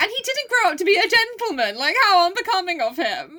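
high, mock-appalled voice; hammed-up tutting disapproval. Mocking those who scorn his upbringing, high mock disapproval.

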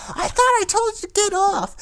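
imitated mom's high-pitched screech; yelling mom. Friend's mom ordering him off the game, mock yelling. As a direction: yell like a mother scolding from another room. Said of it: "you just hear his mom yell".

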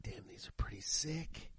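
hushed, breathless whisper of admiration; whispered awe. Retold or imitated. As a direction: whisper it like you're eyeing something you want. Admiring SodaStreams in a store.

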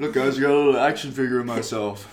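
[name] impression. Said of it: "Made me feel like [name]".

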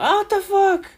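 jolted awake, squeaking out the question; mock startled outcry. Woken up by the noise.

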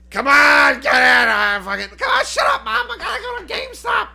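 put-on high, loud bratty kid in a minivan; mock whiny teenager shouting; whiny kid yelling. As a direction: yell at Mom like a spoiled kid.

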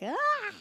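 a short high startled ah; mock scared yelp. Woman retelling a scary takeoff, high frightened ah. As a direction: yelp like the plane just dropped.

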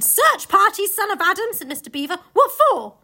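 high loud incredulous asking; puzzled at the silly plan; baffled Beaver. Acted.

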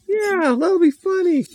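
put-on very high, eager girl voice; gleeful little girl Mary Lou. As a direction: squeal the plan like a mischievous kid.

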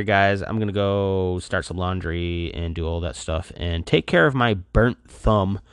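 ordinary talk, nothing marked

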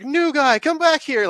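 high-pitched call to the new guy; boss calling out. Retold or imitated. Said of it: "and the guy's like".

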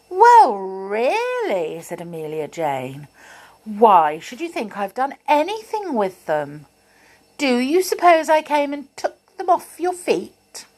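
high, put-on indignant doll voice; cheeky doll protesting, mock offended. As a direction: act outraged innocence. Amelia protesting innocence.

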